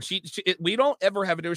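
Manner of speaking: usual speaking voice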